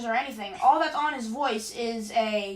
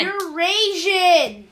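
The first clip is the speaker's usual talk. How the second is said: teasing yell; 5 semitones higher, 7 dB louder, much slower than usual. Pretend.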